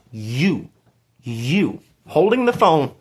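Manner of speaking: suddenly high and loud, pointing at the viewer; excited direct address. Real, not acted. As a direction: call out to the one holding the phone.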